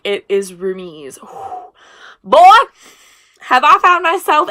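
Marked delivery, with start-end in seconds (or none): 2.2-4.5 gushing. Real, not acted. Gushing about new author.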